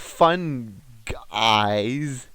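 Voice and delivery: FUN G-EYES said as separate words, slowly; punning split. Pretend. Host making a pun on fungi to co-host, punning split.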